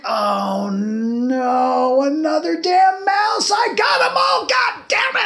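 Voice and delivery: drawn-out rising howl of dismay; exasperated reaction. Retold or imitated. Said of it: "that's basically my reaction".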